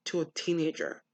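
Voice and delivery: ordinary talk, nothing marked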